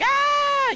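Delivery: very high, loud startled shriek; mock terrified scream. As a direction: shriek like frightened kids, playfully. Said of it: "And they're like".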